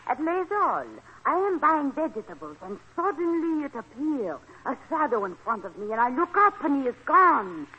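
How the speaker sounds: dramatic tale in a put-on French accent; phony French accent. French guest recounts seeing Bluebeard to the boarders, phony French accent. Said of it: "My phony French accent".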